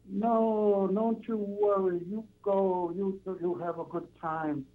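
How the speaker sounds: put-on high, slow old Italian grandmother in broken English; slow, high Italian granny accent. Caller mimics an Italian grandmother to the host, high slow Italian accent. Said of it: "You, you do that in an Italian accent".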